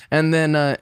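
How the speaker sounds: ordinary talk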